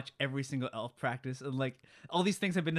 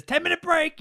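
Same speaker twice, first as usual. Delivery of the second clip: loud, high mock boss yell; imitated boss shout; 14 semitones higher, 8 dB louder than usual. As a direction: call it out like a foreman. Quoting shouting elf boss.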